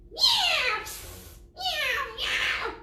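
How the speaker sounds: high yowling meows; cat meowing. Acted. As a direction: meow like scared cats. Cats in story.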